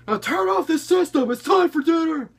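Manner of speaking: loud, high-pitched angry dad yell; father screaming. Retold or imitated. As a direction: scream orders at your kid. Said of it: "to just stop and scream".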